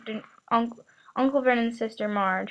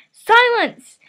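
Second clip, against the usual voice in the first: much higher, louder yell; 12 semitones higher, 9 dB louder than usual. A narrator's reading. Uncle yelling at the boy in the cupboard. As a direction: bellow for silence. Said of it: "yelled Uncle Vernon".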